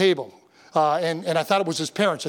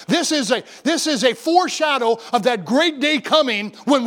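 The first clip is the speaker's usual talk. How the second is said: higher, emphatic pulpit delivery; preaching; 5 semitones higher than usual. Real, not acted. Pastor expounds the triumphal entry to his congregation, preaching. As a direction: preach it firmly, drawing out key phrases. Preaches and reads scripture aloud.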